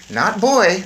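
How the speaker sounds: lightly voiced young boy. A narrator's reading. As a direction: correct her, a small boy.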